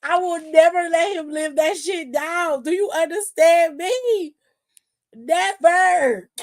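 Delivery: emphatic vow. Real, not acted. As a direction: swear you'll never drop it. Vows never to let it go.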